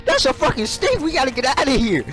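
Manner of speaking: mock friend shrieking at the snake; friend panicking.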